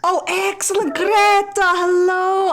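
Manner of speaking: high, loud, delighted hail; loud, overbright hello; effusive greeting. Acted. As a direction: greet her as if caught but thrilled. Character hails Greta in play.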